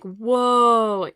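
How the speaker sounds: mock startled exclamation, loud; startled whoa. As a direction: caught off guard by the slammed door. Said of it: "I'm just like".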